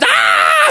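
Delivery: put-on very high-pitched kids' scream. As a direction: shriek in mock terror. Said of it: "they all screamed out".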